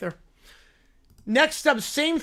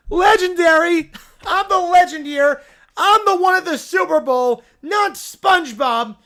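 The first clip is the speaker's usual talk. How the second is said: higher, louder self-important yell; bratty shouting; 4 semitones higher, 5 dB louder than usual. Acted. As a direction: demand the spotlight like a spoiled kid. Ron Bear claims spotlight.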